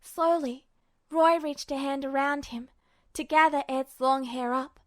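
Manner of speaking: usual speaking voice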